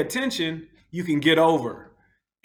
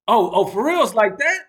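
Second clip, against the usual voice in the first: angry driver confronting; 7 semitones higher, 5 dB louder than usual. Retold or imitated. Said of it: "And he said".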